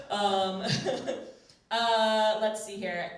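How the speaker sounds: ordinary delivery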